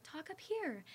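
high head-voice demonstration; talking up high. Shown as a demonstration. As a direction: lift the voice way up, like a voice teacher. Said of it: "they told me to, like".

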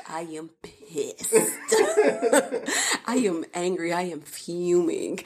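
half-laughing about being furious; fuming but cracking up; laughing through it. Real, not acted. Wife laughing while telling husband she was angry. Retelling her anger, laughing.

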